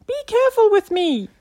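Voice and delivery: very high, loud little creature voice; indignant tiny caterpillar squeak. A narrator's reading. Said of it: "squeaked the eyebrow".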